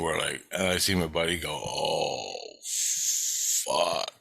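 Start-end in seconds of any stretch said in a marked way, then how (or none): 1.5-4.1 drawn-out, hushed "oh" of dismay; retold awed dread. Buddy seeing Godzilla's head rise, retold awed dread. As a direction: let it out slowly, mock dread. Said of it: "like that, right?".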